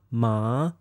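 MA starts low and rises; rising tone. Shown as a demonstration. Teacher demonstrates Chinese sounds, rising tone. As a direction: lift MA upward like a question. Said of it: "if I use the Chinese sounds".

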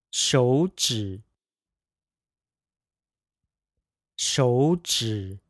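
手指 with the first third tone turned into a second tone; tone sandhi. Shown as a demonstration. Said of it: "pronounced as a second third tone pair".

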